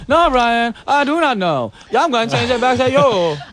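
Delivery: imitating a monotone robot; mock monotone. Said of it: "You so monotone".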